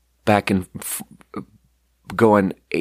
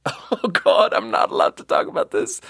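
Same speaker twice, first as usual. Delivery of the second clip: breathless high laugh-whisper; 9 semitones higher than usual. Real, not acted. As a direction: whisper through the giggles. Laughing aside, can't stop telling.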